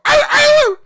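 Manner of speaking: high, loud yelping barks; mock dog barking. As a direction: bark like a spooked dog. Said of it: "one of my roommates gonna go".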